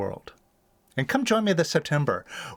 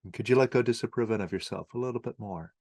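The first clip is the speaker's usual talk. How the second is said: lower, softer meditation guide with long pauses; guided meditation; 6 semitones lower, 4 dB quieter than usual. Acted. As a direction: gently lead the listener through letting go, leaving long silences.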